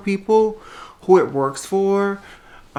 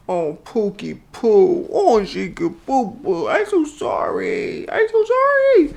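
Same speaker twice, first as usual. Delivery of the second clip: slow, high-pitched pet-cooing voice; 6 semitones higher, slower than usual. Real, not acted. Comforting the unwell dog Lucy May, cooing baby talk. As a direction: coo pityingly, pitch climbing into a tiny squeal.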